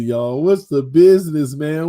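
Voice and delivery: ordinary talk, nothing marked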